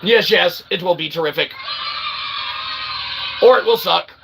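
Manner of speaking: ordinary talk, nothing marked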